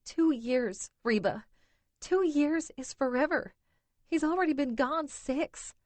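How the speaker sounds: crying that the wait is endless; racking sobs. A narrator's reading. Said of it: "Winona's racking sobs".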